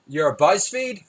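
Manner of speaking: high, loud confused blurt; mock blurted question. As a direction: blurt it out like you misheard. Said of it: "and I say".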